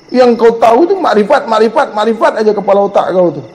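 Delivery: normal talking voice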